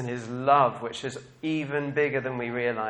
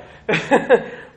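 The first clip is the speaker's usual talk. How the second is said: burst of laughter; 8 semitones higher, 11 dB louder than usual. Real, not acted. Laughing at Peter diving in.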